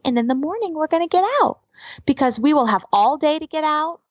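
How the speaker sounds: acted upbeat reassurance for a little one; mother soothing child. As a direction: sell a bleak plan to a child as a treat. Mother cheers up scared child.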